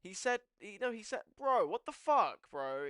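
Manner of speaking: high, loud, exasperated; can't believe he deleted it; outraged disbelief. Real, not acted. Yelling at the chat about Zerka, high loud outrage.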